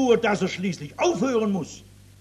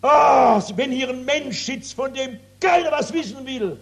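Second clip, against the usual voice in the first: sudden, much louder, higher outcry; preaching shout; 5 semitones higher, 4 dB louder than usual. Real, not acted. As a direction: burst out in full preaching force. Preacher calls out to congregation.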